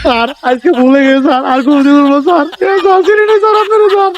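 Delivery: pleading. Acted. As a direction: beg for mercy, rising in panic. Beaten boss begs for mercy.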